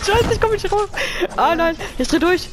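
high frantic cries; panicked yelling. Real, not acted. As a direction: yell it in a panic. Stuck in game, panics.

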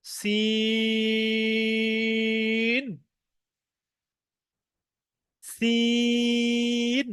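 সিন held long and thin; drawn seen. Shown as a demonstration. Teacher introducing সিন, drawn out. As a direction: stretch সিন with a light s. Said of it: "তারপরে আসছে".

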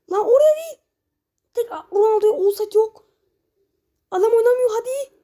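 high, loud yell of protest; frustrated yell. Real, not acted. Gamer yelling at his team's bad pass, high and loud. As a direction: shout at the screen, not there.

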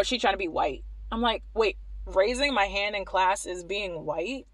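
ordinary talk, nothing marked

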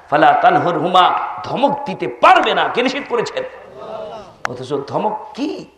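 usual speaking voice

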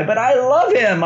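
a hint of a wife gushing over her husband; mock wife's fond sigh. The wife realizing she still loves her husband, lightly voiced.

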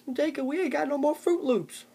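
high, whining complaint; whiny Tony. Retold or imitated. Tony whining to Jacob about the missing Fruit Loops, high and whiny. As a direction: whine it like a big man denied his cereal.

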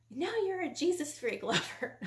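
high-pitched scornful jab; re-enacted taunt at a husband; ripping into him. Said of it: "ripping into him".

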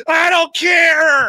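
loud, slow, high flat-out refusal; Tommy Lee Jones. Retold or imitated. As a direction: bark it slowly, not caring at all. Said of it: "Tommy Lee Jones is like".

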